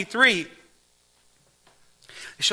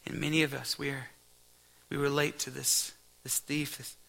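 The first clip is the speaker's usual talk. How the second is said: soft, quiet pastoral prayer; hushed closing prayer over the church; 3 semitones lower, 5 dB quieter than usual. Real, not acted. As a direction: pray it quietly to God.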